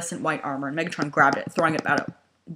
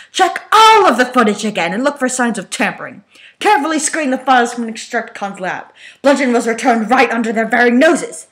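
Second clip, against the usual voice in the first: furious rant; 7 semitones higher, 10 dB louder than usual. Acted. Megatron raging at Soundwave over the missing Autobot. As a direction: rage at his failing operatives. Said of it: "just as loud and furious".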